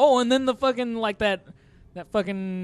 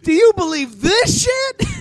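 even higher, louder laughing shout; louder echo shout; 14 semitones higher, 9 dB louder than usual. Retold or imitated. Echoing his friend's quoted line, shouting higher and louder. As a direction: top the friend's yell with more outrage.

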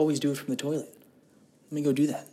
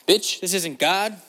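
mock sassy retort; 8 semitones higher, 5 dB louder, slower than usual. Mock rebuttal to Tyson.

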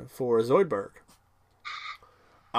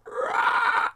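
mock mating roar. Zoidberg on the sand mound, mock mating roar. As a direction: bellow like a crab showing off. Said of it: "starts going".

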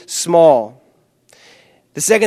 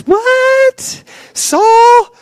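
high, louder shocked squeal; scandalized queen shrieking at her husband; shrieking What? Saul?; outraged shriek; 4 dB louder than usual. Retold or imitated. Queen reacting to insult.

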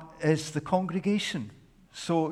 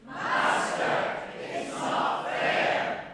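louder second try at the line; speak very loud; 4 dB quieter, much slower than usual. Real, not acted. Congregation repeating it louder for the king, now loud. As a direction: say it so the deaf king hears. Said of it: "they had to speak very loud".

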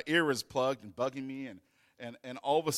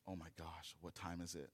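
hushed realisation; 6 semitones lower, 17 dB quieter than usual. Retold or imitated. Sudden hushed realisation at night.

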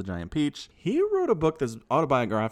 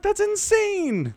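much higher, louder and slow, stretched-out disbelief; incredulous exclamation; 7 dB louder, much slower than usual. Retold or imitated. Reacting to a story about caning at boarding school, much higher, slow incredulous outburst. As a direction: let the outrage burst out, drawn out. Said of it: "And I'm just like".

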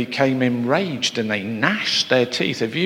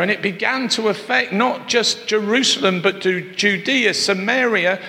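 higher, ringing preacher's build; rising preaching climax; 7 semitones higher than usual. Acted. Preacher building the congregation up, higher and ringing. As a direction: lift the church toward the ending.

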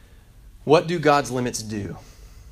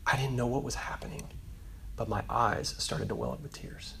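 soft, low-key retelling of tears coming; hushed and understated; quiet, moved recollection; 3 semitones lower, 11 dB quieter than usual. Retold or imitated. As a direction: drop the volume, let it sink in. Boy retells welling up.

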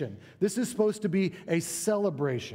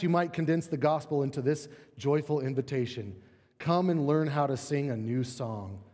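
ordinary talk, nothing marked

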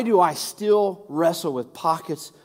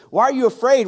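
louder and higher, a sharp challenge; rebuking; 6 semitones higher, 7 dB louder, faster than usual. Retold or imitated. Jesus challenging fearful disciples, louder and higher. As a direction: press the disciples hard. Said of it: "he said".

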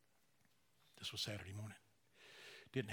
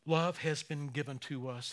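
normal talking voice

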